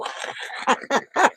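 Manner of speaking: wheezy silent giggle; helpless breathy laughter; laughing too hard to make a sound; breathless laugh. Real, not acted. Knickers fly off the fan.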